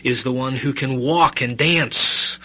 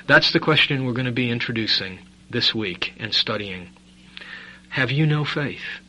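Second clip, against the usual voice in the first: normal talking voice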